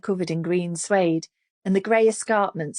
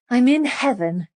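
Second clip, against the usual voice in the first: louder, higher grand stage declaration; theatrical declaiming; 7 semitones higher, 5 dB louder than usual. A narrator's reading. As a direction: declaim it like a hammy stage actress. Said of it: "she declaims in her best theatrical voice".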